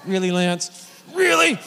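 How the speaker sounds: sarcastic disbelief; mock exasperation. Speaker answering Lance's Bible advice, exasperated.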